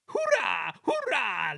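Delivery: high, loud, gleeful cheering; mischievous trucks cheering. Acted. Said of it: "laughed the trucks".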